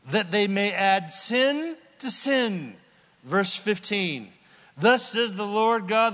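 loud, slow, fervent preaching; preaching at full force. Acted. Preacher to congregation, loud fervent preaching. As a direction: pound the scripture home from the pulpit.

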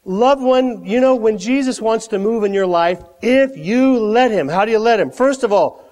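ordinary delivery

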